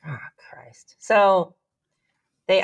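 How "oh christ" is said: weary curse under the breath; exasperated whisper. Retold or imitated. Daughter reacting to dad wanting his lottery ticket, exasperated whisper. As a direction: whisper it to yourself in dismay.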